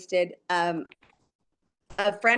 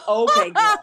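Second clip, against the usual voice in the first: put-on sassy okay girl; 8 dB louder than usual. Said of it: "I'm like".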